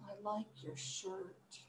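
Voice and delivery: very soft, slow, sad delivery. Shown as a demonstration. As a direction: say it sadly, barely audible. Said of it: "Let's do this one, sad".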